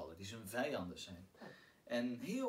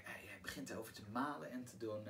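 normal talking voice

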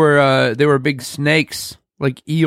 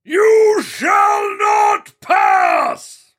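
very slow, much higher roared wizard command; angry Gandalf shout; much slower than usual. Pretend. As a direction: bellow it to stop the monster. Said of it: "you gotta get angry ... shout".

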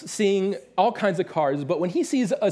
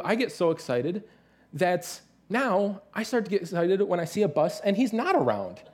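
normal talking voice

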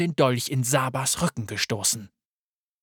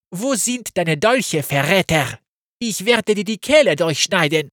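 menacing threat; 5 semitones higher, 8 dB louder than usual. Acted. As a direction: threaten to cut his throat. Saba threatens the traitor.

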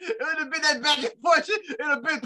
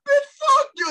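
higher, louder burst of retold outrage; mock angry outburst; 10 semitones higher, 7 dB louder than usual. Friend acting out a Vegas quarrel, mock angry outburst. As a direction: throw the insult out as a mock yell.

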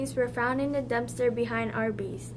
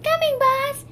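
eager henchman; 11 semitones higher, 5 dB louder than usual. Acted. Eager henchman answers boss.

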